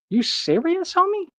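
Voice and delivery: can't believe what he's hearing, voice shooting up; incredulous. Real, not acted. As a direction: pitch flying up high in disbelief. Incredulous rant at critics.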